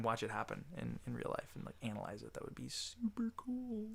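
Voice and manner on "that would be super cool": whispered. Real, not acted. Whispered wish.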